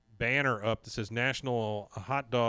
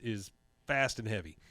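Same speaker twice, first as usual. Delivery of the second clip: usual speaking voice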